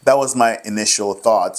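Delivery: usual speaking voice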